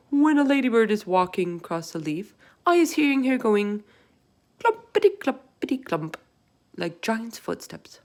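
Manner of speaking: big gentle giant voice, loud; BFG voice. A narrator's reading. Narrator voices the giant to Sophie. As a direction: voice the giant with wonder. BFG voice in read-aloud story.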